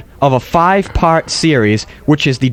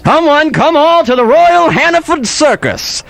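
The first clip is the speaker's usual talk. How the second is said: circus barker; 10 semitones higher than usual. Pretend. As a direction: call the crowd in like a showman. Circus barker calling crowd.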